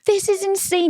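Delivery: very high, gasped disbelief; acted amazement. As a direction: gasp it out, astonished at a wild show. Acting out amazement at wild show.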